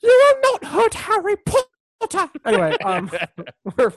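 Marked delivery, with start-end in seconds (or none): 0.0-2.3 slow, very high, loud elf warning; hammed-up elf defiance, drawn out; Dobby. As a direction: forbid it in a squeaky house-elf voice. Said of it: "that house elf's name from".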